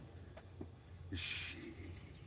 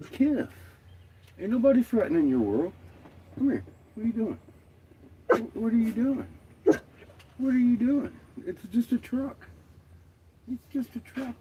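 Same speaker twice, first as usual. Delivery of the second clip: high sing-song pet voice, much higher; talking to the dog; 12 semitones higher than usual. Real, not acted. Owner coos at her curious dog, high pet-talk voice. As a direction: coo up high like talking to a puppy.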